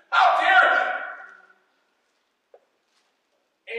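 loud, high, shouting outrage at the servant; indignant outburst. Retold or imitated. Preacher voicing his own judgment of the servant, indignant shouting. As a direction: rant at him like he's right in front of you. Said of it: "I'm like".

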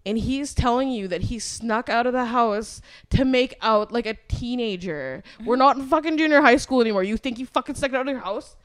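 loud furious rant; loud, heated, scolding rant; unhinged rant. Retold or imitated. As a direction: rant at her in full anger. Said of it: "I'm unhinged now".

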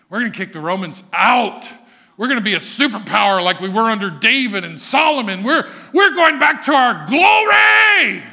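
disciples' triumph. Acted. Disciples dreaming of kicking the Romans out, building to a shout. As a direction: swell into a victory shout. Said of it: "his disciples are thinking".

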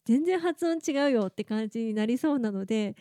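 ordinary delivery

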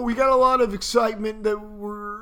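high, put-on coach's press-conference voice; Doc Rivers impression. Mocking coach at presser, high put-on coach voice. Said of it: "that was my Doc Rivers".

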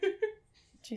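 hiccuping mid sentence. Real, not acted. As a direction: voice catching on a hiccup. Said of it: "oh, sorry, I hiccuped".

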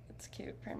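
breathy whispered aside; whispering. Real, not acted. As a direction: drop to a whisper.

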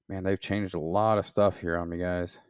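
ordinary delivery

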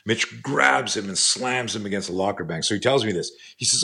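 ordinary delivery

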